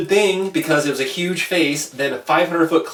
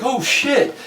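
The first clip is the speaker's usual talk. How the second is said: much higher retold yelp of alarm; alarmed oh shit; 12 semitones higher than usual. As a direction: voice his sudden fright as the slide hits. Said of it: "he's like".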